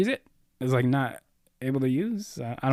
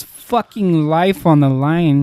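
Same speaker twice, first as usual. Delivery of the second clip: mock solemn tribute; 5 semitones higher, 8 dB louder, slower than usual. Mock tribute to host's sacrifice.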